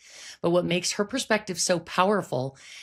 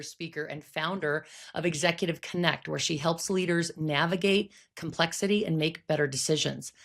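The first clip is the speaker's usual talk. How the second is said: ordinary talk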